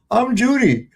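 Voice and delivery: mock Judy. Mimicking the pancake commercial.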